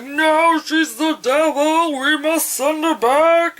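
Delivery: high, loud frantic cultist cry; mock scream. Cult member protesting the girl's rescue, high mock screaming. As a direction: cry it out drawn-out and high, like a fanatic. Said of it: "I shouldn't be screaming that loud".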